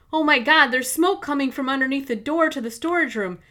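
loud, high panicked cry; panicked shout. Acted. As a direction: yell it in terror. Smoke pours from the door.

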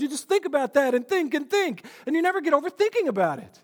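usual speaking voice

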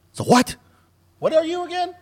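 high-pitched, oblivious retold questions; clueless. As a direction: act out not getting it at all. Retelling his clueless questions.

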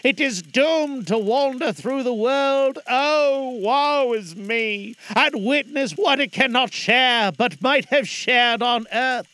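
loud, lamenting phantom; mournful ghost voice. Acted. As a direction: wail the doom like a chained spirit. Ghost's doom speech.

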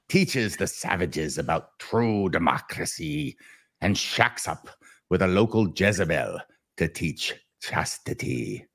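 slow dramatic movie trailer read; Billy Zane voice. Acted. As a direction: read it like an epic trailer narrator. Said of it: "now I'll read it in my Billy Zane voice".

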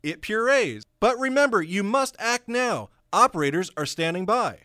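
loud, high hard-sell pitchman; home shopping host. Acted. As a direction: push it like a TV infomercial. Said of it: "this hard sell".

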